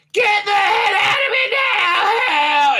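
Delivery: high, strained shrieking yell; mock panicked scream. Tilly screaming to be beamed out before being eaten.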